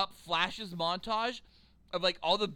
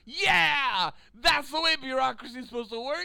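sarcastic; 5 semitones higher, 6 dB louder than usual. Retold or imitated. Sarcastic inner thought.

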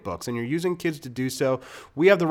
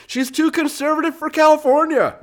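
much higher, louder sarcastic disbelief; incredulous mockery; 7 dB louder than usual. Real, not acted. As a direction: read it as if it's absurd. Mock reading of absurd claim.

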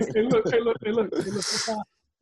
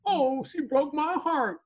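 drawn-out, higher mock lament; slow, higher-pitched fake pining; mock heartbreak; 4 semitones higher, slower than usual. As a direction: wail it slowly, making fun of the heartbroken. Mimics a heartbroken man.

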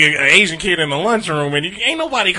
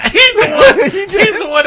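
high laughing accusation; 14 semitones higher, 5 dB louder than usual. Real, not acted. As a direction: laugh while pointing blame.

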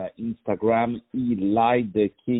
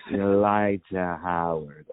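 slow, lower-pitched smooth delivery of the name; low, unhurried, would-be smooth flow; smooth imitation; 4 semitones lower, slower than usual. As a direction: draw the name out suavely, imitating the guest. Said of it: "One day I will be smooth like that".

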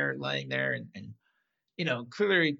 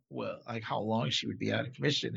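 usual speaking voice

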